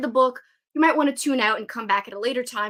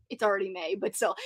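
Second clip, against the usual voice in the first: usual speaking voice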